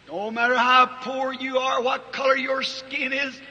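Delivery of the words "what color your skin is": ordinary delivery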